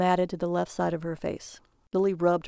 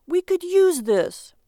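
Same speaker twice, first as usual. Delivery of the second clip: high, slow, loud protest; bewildered husband insisting; puzzled; 10 semitones higher, 7 dB louder, slower than usual. A narrator's reading. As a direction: plead his case, baffled. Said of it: "Barry was puzzled".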